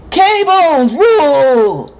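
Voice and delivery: loud, high, drawn-out gang cry; ratty call-out. A narrator's reading. Boy taunts a rival gang, calling out rattily. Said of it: "his voice is as ratty as the freeway pole".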